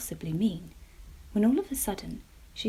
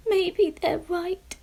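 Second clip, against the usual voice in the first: slow, high tearful voice; sniffling; 10 semitones higher, 6 dB louder, much slower than usual. Acted. Jemima sits alone crying, slow and tearful. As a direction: sniff it out, close to tears. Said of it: "she sniffed".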